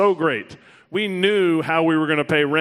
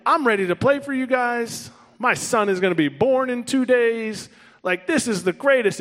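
bright, raised, eager voice; eager excitement; 7 semitones higher than usual. Retold or imitated. To the team, eagerly telling them he's ready. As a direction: sound thrilled and ready to go. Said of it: "I'm sitting here like".

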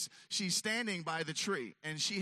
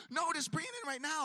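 higher, quick no-nonsense order; insistent wife; 9 semitones higher, faster than usual. Retold or imitated. Wife demanding the tree come inside, insistent. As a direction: insist it comes in now. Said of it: "She's like".

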